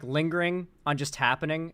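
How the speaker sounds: ordinary delivery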